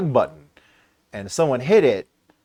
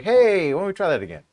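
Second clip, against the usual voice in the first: high, loud, chirpy opener; mock-innocent upbeat proposal; eager suggestion; 8 semitones higher, 5 dB louder than usual. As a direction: pitch it like a cheeky instigator. Voices the viewers' eager return.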